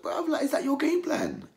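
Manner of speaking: high, disbelieving mockery; incredulous. Real, not acted. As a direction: scoff at the plan. Mocking cornerman, disbelief.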